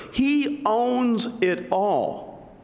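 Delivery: He owns it all, drawn out slowly, each word weighted; slow emphatic. Shown as a demonstration. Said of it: "that mindset that is so critical".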